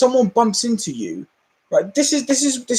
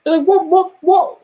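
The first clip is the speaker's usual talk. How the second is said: baffled what; 12 semitones higher, 5 dB louder than usual. Real, not acted. Baffled by charity shop offer.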